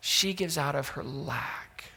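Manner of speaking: she gives out of her lack said slowly and quietly, each word set apart. Shown as a demonstration. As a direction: let the repeat sink in softly.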